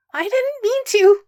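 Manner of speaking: high, loud panicked squeak; frightened squeak. A narrator's reading. Boy apologizing to the startled pack, high frightened squeak. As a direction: squeak it out like a deer caught in headlights. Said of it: "Stiles squeaks out".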